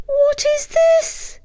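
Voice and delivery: very high, slow astonished question; astonished wife. Acted. Wife seeing the fairy and gold, very high astonishment. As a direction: gasp it out amazed. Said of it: "she asked".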